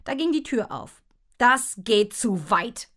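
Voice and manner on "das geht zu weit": loud, slow, dad's outraged call; Papa's put-on voice, dragged out in protest. A narrator's reading. Dad objects to the goose over the bathtub, loud and slow. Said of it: "rief Papa".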